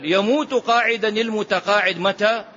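usual speaking voice

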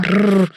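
rolled growling "rrr"; short trilled snarl of annoyance; frustrated growl. Acted. Heroine recalls his mother walking in, frustrated growl.

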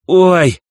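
высокий протяжный вскрик «ой»; вскрикни громко и высоко. A narrator's reading. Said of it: "снова вскрикнула мать". (крик роженицы от схватки)

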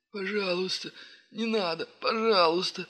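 high, tearful begging; wailing plea. Acted. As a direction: plead and lament. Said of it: "причитал человек".

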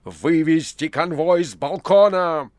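shouted command. A narrator's reading. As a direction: bark the guards off the balcony. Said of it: "Тут Пилат вскричал".

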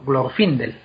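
"Glorfindel" stressed on FIN, falling; contrasted stress on FIN. Shown as a demonstration. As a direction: say "Glorfindel" as glor-FIN-del. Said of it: "se lleva la, el acento".

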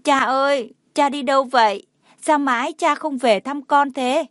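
acted tearful child's complaint. A narrator's reading. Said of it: "khóc nức nở".

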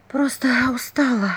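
tired, slow whisper. Acted. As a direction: breathe it out, exhausted. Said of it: "прошептала Василиса".

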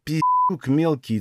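drawn-out venom through gritted teeth; seething through teeth. A narrator's reading. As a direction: squeeze each word out slowly. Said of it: "цедила она в ответ".

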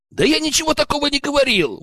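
high-pitched protest of innocence; indignant. A narrator's reading. Accused lad defends himself to the leader, indignant. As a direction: protest indignantly, voice jumping right up. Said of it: "возмутился Глеб".

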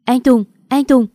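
high, loud frantic call; screaming. A narrator's reading. Hiền screaming for Tùng from behind, frantic. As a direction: scream his name in panic. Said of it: "gào lên".

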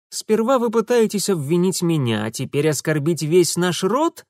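put-on affronted young prince; frowning reproach. A narrator's reading. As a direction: bristle at the insult to the clan. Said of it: "нахмурился Урусов".

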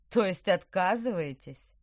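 loud, gloating rich villain; a smug villain, sneering loudly. A narrator's reading. Villain incredulous.

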